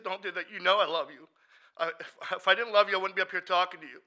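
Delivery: high, loud, insistent anger; angry pleading. Retold or imitated. Assuring a friend he is loved, angry shout. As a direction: raise the voice to prove you care. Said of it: "trying to scream at him".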